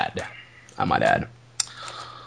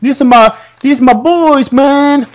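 Carmella; 10 semitones higher than usual. Retold or imitated. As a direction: imitate an indignant wrestler's manager, pitched way up. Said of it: "the Carmella said".

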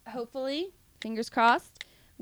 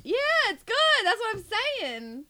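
high-pitched, insistent defence; emphatic insistence; 10 semitones higher than usual. Real, not acted. Co-host defending a favourite song to the host, high and emphatic.

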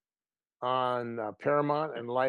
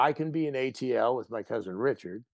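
ordinary delivery

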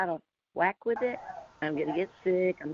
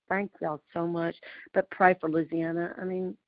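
usual speaking voice

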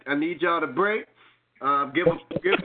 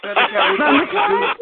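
loud laughing echo, higher; laughing shout; 7 semitones higher, 9 dB louder than usual. Real, not acted. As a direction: shout it back through a laugh.

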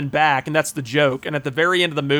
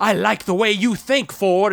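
higher imitated assassin voice; Robert Englund; 4 semitones higher than usual. Assassin accepting Ford's fistfight, higher imitated villain voice. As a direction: play the villain taken with the idea, pitched up. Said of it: "And Robert Englund's like".

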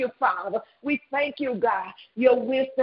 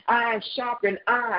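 normal talking voice

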